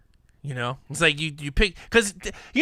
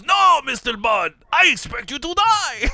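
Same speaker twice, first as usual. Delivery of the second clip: mock movie villain; 5 semitones higher than usual. Co-host quoting the famous Goldfinger scene, hammed-up villain. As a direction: boom it like a film villain, close to laughing. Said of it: "And the guy goes".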